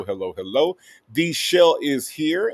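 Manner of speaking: ordinary talk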